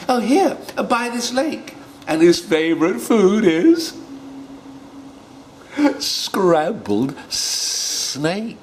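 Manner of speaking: high squeaky little mouse; a tiny mouse boasting. Acted. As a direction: play the mouse high and bright. Mouse voiced high in story.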